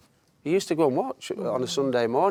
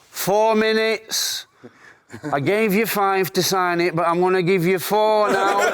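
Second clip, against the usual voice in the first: imitating the boss; 8 semitones higher, 4 dB louder, slower than usual. Boss pressuring him to sign.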